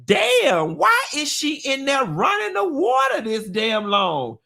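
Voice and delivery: high baffled inner voice; puzzled exasperation. Retold or imitated. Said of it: "I was like".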